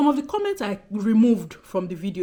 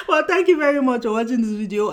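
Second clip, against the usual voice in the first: loud, higher, straight out of a laugh; amused sign-off; 4 semitones higher, 8 dB louder than usual. Real, not acted. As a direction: thank them loudly, still tickled by your own joke. Still amused after laughing, signing off.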